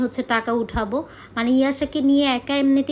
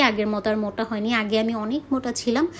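ordinary delivery

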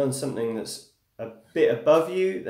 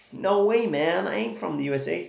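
a jokey, bad American accent; hammed-up American twang, deliberately terrible; 4 semitones higher than usual. Answering a viewer who asks if he's from the USA, in a mock American accent. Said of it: "That's a terrible American accent".

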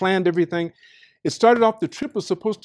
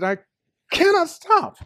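inner voice; 11 semitones higher, slower than usual. Retold or imitated. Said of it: "something inside me said".

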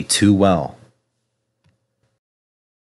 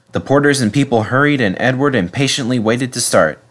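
ordinary delivery, nothing marked

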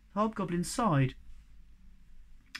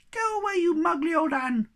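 much higher, louder tiny defiant voice; squeaky little creature; 12 semitones higher, 5 dB louder than usual. Acted. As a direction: pipe up like a tiny brave creature.

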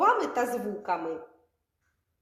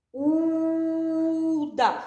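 Удав with the opening У held long and a bit louder; stretched first sound; 4 semitones higher, 3 dB louder than usual. Shown as a demonstration. Teacher stretching the first sound for children. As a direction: draw out the У of Удав. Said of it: "Слухайте уважно".

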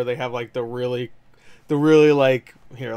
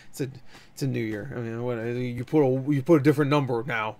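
normal talking voice

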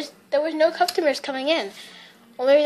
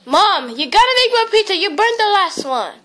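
raised, loud complaint to Mom; calling out urgently; 6 semitones higher, 7 dB louder than usual. Acted. Child telling mom she burned the pizza, urgent shout. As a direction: shout it to someone in another room.

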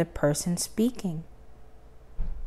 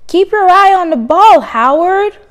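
high, loud call across the playground; teammates shouting; 11 semitones higher, 9 dB louder than usual. Acted. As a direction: yell the tip to a teammate.

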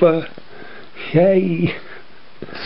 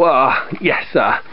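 ordinary delivery

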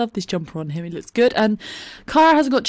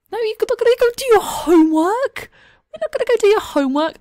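mock scolding; 12 semitones higher, 3 dB louder than usual. Real, not acted. Scolding the sim playfully.

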